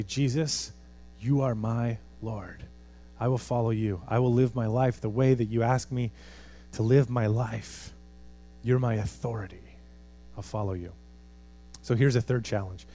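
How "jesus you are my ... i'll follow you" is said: prayer. Shown as a demonstration. Preacher leading the congregation in a soft surrender prayer. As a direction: lower and quieter, offer it sincerely.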